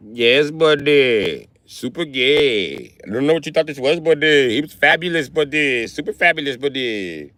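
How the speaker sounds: hammed-up Indian-accent impression, each phrase stretched; mock Indian accent. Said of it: "you got a fucking Indian accent".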